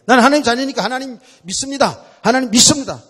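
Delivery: loud, high, demanding prayer; pleading believer. Retold or imitated. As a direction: beg God like a stubborn child. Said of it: "떼쓰는 거야". (떼쓰듯 높고 큰 기도)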